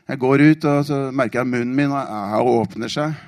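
ordinary talk

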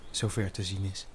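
usual speaking voice

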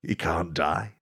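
ordinary talk, nothing marked

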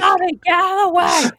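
loud, high joking yell; mock shout. Joking to friend about clearing a celebrity away, mock shout. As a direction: pretend to yell someone out of your path. Mock yelling at someone blocking the bar.

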